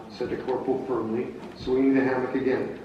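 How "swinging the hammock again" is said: plain reading voice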